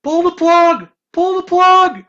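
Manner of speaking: high, very loud mock-alarmed shouting; panicked yell. Listener inwardly reacting to scary robot, panicked yell. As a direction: yell it like an emergency, far above normal pitch. Acts out panicked inner thought.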